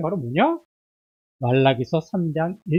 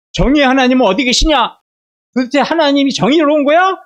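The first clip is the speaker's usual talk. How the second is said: loud, raised-pitch scoffing demand; jeering challenge; 6 semitones higher, 6 dB louder than usual. Acted. People of Judah jeering about the God of justice, scornful and loud. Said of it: "이렇게 비아냥거리자".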